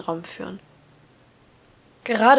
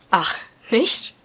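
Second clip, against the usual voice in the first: surprised and sharp; 3 semitones higher, 5 dB louder than usual. A narrator's reading. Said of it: "Mischung aus Verblüffung und unerwarteter Schärfe".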